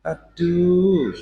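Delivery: high, drawn-out swooning exclamation; sultry sighing. Shown as a demonstration. Mocking a sighing female reciter, swooning moan. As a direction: moan it high, then let it fall. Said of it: "jangan suara sing mendesah".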